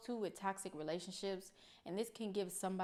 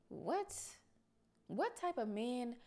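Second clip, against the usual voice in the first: shocked what; 5 semitones higher, slower than usual. Real, not acted. Reacts to man's cruelty.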